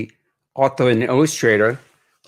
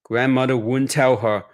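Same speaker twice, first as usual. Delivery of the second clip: put-on reading aloud to children, lively and higher; picture book narration; 4 semitones higher than usual. A narrator's reading. Author reading his Chinatown memoir to children, expressive storytelling. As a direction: paint each image for young listeners.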